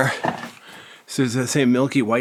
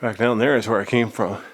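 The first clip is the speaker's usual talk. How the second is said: usual speaking voice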